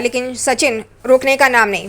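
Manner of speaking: plain reading voice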